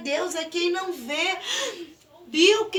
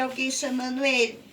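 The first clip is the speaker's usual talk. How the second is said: ordinary delivery, nothing marked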